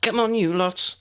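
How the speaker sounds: called out to the group; calling out. Acted. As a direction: call them along. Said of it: "one of the other girls called".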